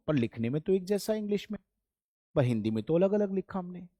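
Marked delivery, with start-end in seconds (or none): none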